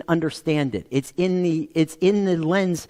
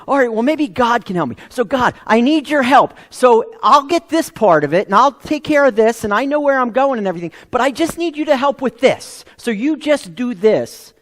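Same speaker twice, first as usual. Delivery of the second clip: bargaining with God; 5 semitones higher, 5 dB louder than usual. Retold or imitated. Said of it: "And you say".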